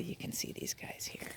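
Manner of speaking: unvoiced whisper while hunting with the dogs; whispering beside the bushes. Real, not acted. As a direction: hush it so nothing is startled.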